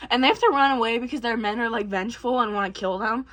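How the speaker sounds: ordinary talk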